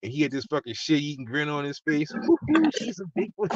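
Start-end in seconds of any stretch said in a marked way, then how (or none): 2.5-3.5 high mocking sing-song tease; ribbing him in a high taunting voice; teasing driver voice. Driver mocks him about his catfish date, high teasing voice. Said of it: "They just ripping me".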